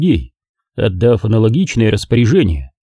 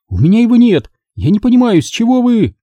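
high, loud, fast exasperated rant; she's at the end of her rope; hysterical outburst; 12 semitones higher, 3 dB louder, faster than usual. A narrator's reading. Margo raging at Engel over the corpse.

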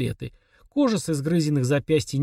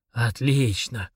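hissed; 3 semitones lower, much slower than usual. A narrator's reading. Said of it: "прошипел он".

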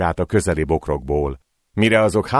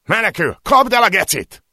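much higher, louder hunting yell; shouted order; 4 dB louder than usual. Acted. Attacker yelling to the others to catch him, shouting. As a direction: bark the chase order at full voice. Attackers chase fleeing man.

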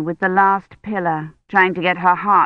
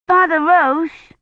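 high, drawn-out calling out; child's shout; 10 semitones higher, 4 dB louder, slower than usual. A narrator's reading. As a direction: call out to the priest, high and slow. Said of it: "Agnes shouted".

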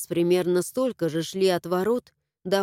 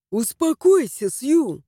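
urgent; 4 semitones higher, 5 dB louder, slower than usual. A narrator's reading. Harash calming Sue.

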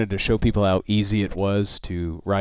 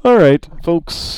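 ordinary talk, nothing marked

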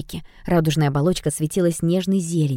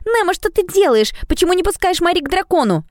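put-on indignant girl; 14 semitones higher than usual. Oda protests to Nemo.

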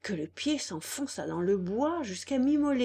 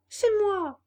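high, louder puppet voice; Pinocchio's eager answer; 12 semitones higher, 6 dB louder than usual. Acted. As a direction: pipe it up like a small wooden boy.

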